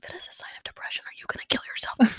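hushed, frantic worried whisper; panicked whisper. Retold or imitated. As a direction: whisper the fear like it's a secret.

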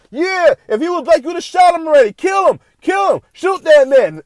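high, loud jeering shout from the onlookers; crowd yelling. Retold or imitated. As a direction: holler it from the sidelines, egging them on. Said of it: "and they're going".

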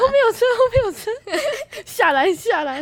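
high, loud call broken by laughter; giggling, half-shouted back-seat warning; laughing warning. Retold or imitated. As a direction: laugh while telling her to get off. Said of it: "我说".

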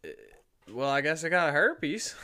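drawn-out mock acceptance, hammed up; mock resigned. Nurse retelling her reaction to the patient, mock resigned and slow. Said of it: "And I'm like".

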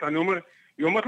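normal talking voice

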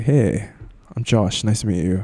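deep breath, then greet her smoothly; calm low date voice. Shown as a demonstration. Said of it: "calm down, take a deep breath".

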